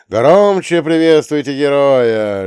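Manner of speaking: loud, slow, high announcer call; arena announcer. Acted. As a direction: present the fighter to a roaring crowd. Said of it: "Голос ведущего".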